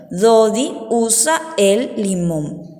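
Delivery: slow, separated words; dictation repeat. Shown as a demonstration. Teacher repeats sentence two for children copying, slow and spaced. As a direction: repeat it slowly for writing.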